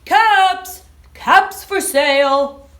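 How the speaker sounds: loud, slow street call; calling out wares. Acted. Peddler calls out his caps for sale, loud and slow.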